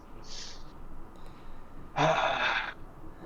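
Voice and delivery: long, voiceless exhale; long sigh. Real, not acted. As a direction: let out a drawn-out sigh. Player sighs before handing over gold.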